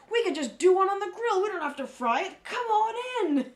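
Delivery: loud, high, hammed-up welcome; eager coaxing. Quoting the restaurant owner winning them over, loud eager invitation. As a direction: imitate an insistent host urging diners inside.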